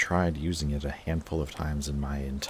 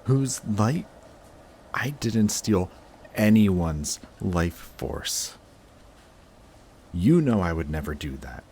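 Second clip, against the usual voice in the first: higher and louder, hurt and offended; offended, raised-voice denial; indignant denial; 4 semitones higher, 5 dB louder than usual. Acted. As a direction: snap back at the accusation. Accused of stealing life force.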